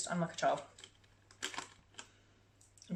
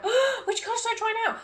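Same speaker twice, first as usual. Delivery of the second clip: much higher and louder, laughing with glee; excited self quote; 6 dB louder than usual. Retold or imitated. Vlogger acts out her thrill at choosing a nail colour, high and laughing. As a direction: gush like a kid picking candy. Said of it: "I'm so excited 'cause I'm like".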